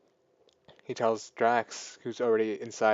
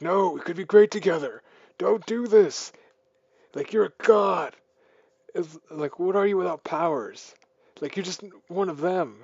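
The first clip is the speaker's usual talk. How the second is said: pleading villain; 7 semitones higher, 8 dB louder than usual. Retold or imitated. Ego pleading with Peter.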